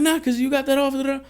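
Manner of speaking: mock the cousin's high, teasing insistence; cousin insisting. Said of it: "He's like".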